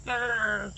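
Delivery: short throaty imitated squawk; imitated chicken cry; mock hen squawk. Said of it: "She just goes".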